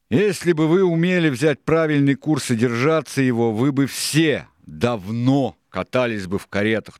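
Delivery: high, loud scolding tirade; Silver, exasperated. A narrator's reading. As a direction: hammer the lesson into the crew.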